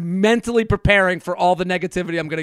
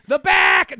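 high mock shout; crowd calling out; 7 semitones higher than usual. People in line shouting over his pickup request. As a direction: bark it like an impatient crowd. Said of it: "They don't even listen to you".

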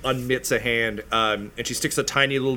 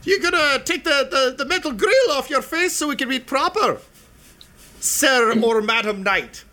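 gnome voice; 11 semitones higher, 4 dB louder than usual. Acted. Gnome asking the knight to lift the visor, high. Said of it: "she's like".

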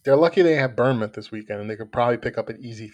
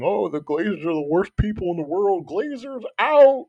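put-on Southern accent; 8 semitones higher than usual. Mocking United fans who blame the Glazers, in a Southern accent. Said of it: "I just did a Southern accent".

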